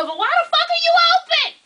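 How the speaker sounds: high, loud outraged question; exasperated rant. Real, not acted. Vlogger ranting to viewers, loud and high. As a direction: vent at the closed salons, pitch way up. Ranting about closed salons.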